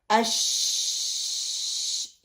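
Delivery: अश with long held SH; sheen sound. Shown as a demonstration. Teacher showing the sound to produce, long SH in अश. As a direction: prolong the hush at the end of अश. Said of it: "ये वाला sound निकले".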